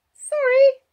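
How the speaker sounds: put-on very high, loud child voice; child's apology. A narrator's reading. Child apologising for wetting him, high child voice. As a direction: apologise as a small girl.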